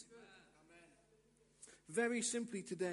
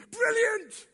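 very high, loud cheer; exuberant approval; 14 semitones higher, 12 dB louder than usual. Acted. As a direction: explode with delight at the admission. Delighted answer to weakness.

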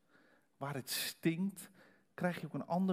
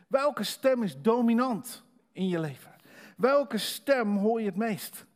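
preaching at full force; 6 semitones higher, 6 dB louder than usual. Acted. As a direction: drive the point home to the church.

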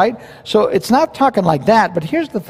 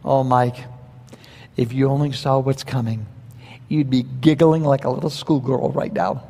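gentle reassurance; 4 semitones lower, 5 dB quieter than usual. Retold or imitated. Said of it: "the Lord is saying to me".

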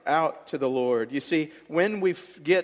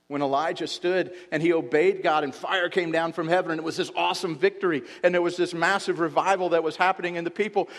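ordinary delivery

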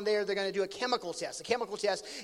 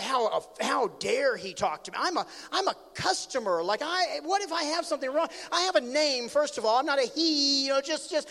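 rising, affronted retort; mock-offended customer's complaint; indignant protest; 5 semitones higher, 4 dB louder than usual. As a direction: act out wounded pride, getting higher. Acts out his own indignation.

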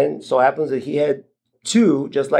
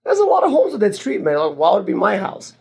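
higher, exasperated disbelief; incredulous retort; 5 semitones higher than usual. Retold or imitated. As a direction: throw it back at him, high and baffled. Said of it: "I'm like".

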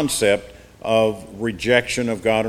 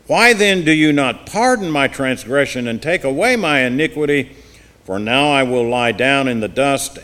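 impassioned Bible reading; forceful scripture reading; 2 semitones higher, 6 dB louder than usual. Acted. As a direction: read it loud and pleading, preacher style.

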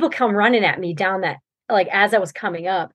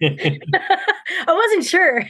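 laughing; 7 semitones higher, much slower than usual. Real, not acted. Laughing at own story.

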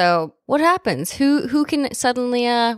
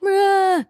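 short high mock yelp; mocking scream; 12 semitones higher than usual. Howard mocking Justin Long's panicked screaming, high mock yelp. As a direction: toss out a teasing fake scream. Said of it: "he's just literally mocking".

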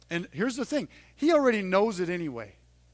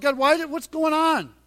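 louder pleading questions to God; anguished complaint; 6 semitones higher, 7 dB louder than usual. Retold or imitated. Moses questioning God, raised anguished plea. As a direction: cry out in confusion and pain. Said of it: "hear the sarcasm in Moses' voice".

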